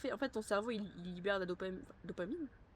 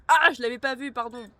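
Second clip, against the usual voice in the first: startled apology; 4 semitones higher, 15 dB louder than usual. Real, not acted. Startled bumping a player.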